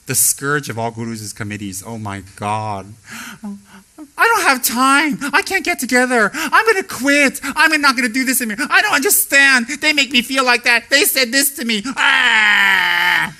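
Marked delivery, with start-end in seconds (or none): 3.4-13.3 high, loud tearful wailing; whining committee member. Retold or imitated. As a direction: wail like a screaming toddler.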